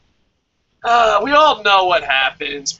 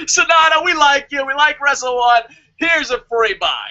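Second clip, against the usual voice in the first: high, loud fawning call; mock booker; 9 semitones higher, 5 dB louder than usual. A booker gushing over Sonata in a loud high voice. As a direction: gush it like a booker playing favourites.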